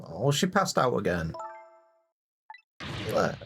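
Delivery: ordinary delivery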